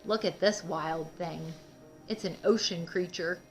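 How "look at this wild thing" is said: ordinary delivery, nothing marked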